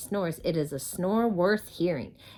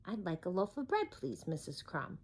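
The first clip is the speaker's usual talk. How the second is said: quietly; 10 dB quieter than usual. Acted. Mister Noisy finally asks the baker's wife politely, quiet. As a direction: ask gently and politely. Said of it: "he said quietly".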